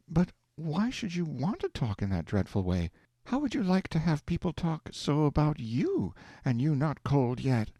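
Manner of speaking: a proper wife, gently appalled; lightly voiced Aleck, disapproving; prim wife reproaching. A narrator's reading.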